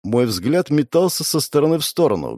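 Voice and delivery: plain reading voice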